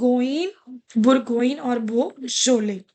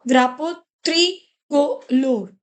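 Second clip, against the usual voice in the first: "Drapo tricolor" drawn out slowly, each syllable clear; slow careful model; slower than usual. Shown as a demonstration. Teacher giving students a slow model, careful and drawn out. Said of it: "it becomes dra".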